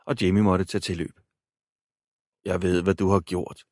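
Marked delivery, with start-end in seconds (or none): none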